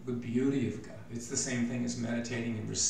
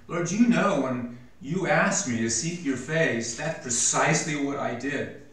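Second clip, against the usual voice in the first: louder, higher earnest prayer; voicing David praying; 3 semitones higher, 7 dB louder than usual. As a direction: speak to God as David would.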